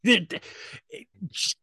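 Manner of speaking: usual speaking voice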